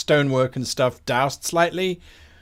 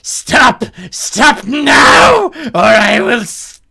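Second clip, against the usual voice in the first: very high, loud frantic pleading; desperate shouting; 9 dB louder, slower than usual. Acted. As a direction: scream pleas from behind as the barrier fails. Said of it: "you hear someone shout".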